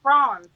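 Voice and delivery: "Franz" loud and bright with an aw vowel; a bold "Franz", the A as in war; trying the name. Shown as a demonstration. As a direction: call out "Franz" with a nasal aw. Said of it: "I like Franz".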